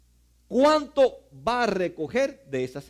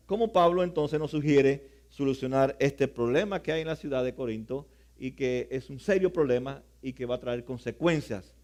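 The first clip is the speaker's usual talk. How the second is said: usual speaking voice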